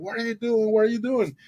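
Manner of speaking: acted alarm, high-pitched and urgent; urgent questioning. Boat owner to resting swimmer, urgent questioning. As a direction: demand it twice, pitched right up.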